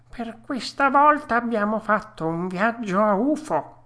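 high put-on creature voice; disgruntled rabbit. Rabbits after a wasted trip, high grumbling voice.

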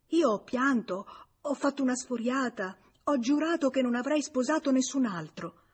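ordinary delivery, nothing marked

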